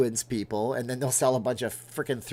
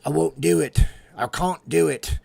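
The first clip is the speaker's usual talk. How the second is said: imitating Jon Snow's stubborn refusal, louder; mock brooding hero refusing, slowing on the second line; 5 semitones higher, 7 dB louder than usual. Vlogger imitating Jon Snow refusing to bend the knee. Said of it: "I'm gonna be like Jon Snow".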